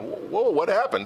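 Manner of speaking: bewildered whoa. Retold or imitated. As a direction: imitate a player left baffled in the dust. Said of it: "G G was there, like, going".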